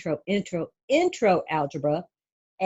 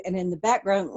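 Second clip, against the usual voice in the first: ordinary delivery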